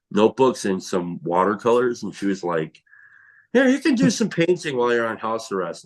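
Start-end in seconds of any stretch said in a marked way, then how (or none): 3.5-5.8 lightly voiced sister-in-law, higher pitch; imitated sister-in-law. Sister-in-law's cheerful suggestion retold.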